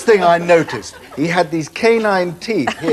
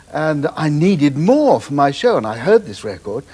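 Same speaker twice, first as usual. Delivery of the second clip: ordinary talk, nothing marked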